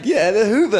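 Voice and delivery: loud, high, insisting on the brand name; hammed-up know-it-all; mock insistent. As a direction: say it like it's obvious. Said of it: "they're like".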